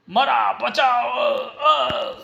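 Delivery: high, slow desperate shout; dying cry. Acted. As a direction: cry out as life fades. Sanga dying from knife.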